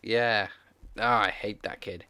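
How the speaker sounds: usual speaking voice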